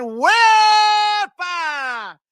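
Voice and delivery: long, high, loud whoop, stretched out; celebratory holler. Real, not acted. Streamer hailing a super chat donor, celebratory holler. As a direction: belt it out, holding the first syllable.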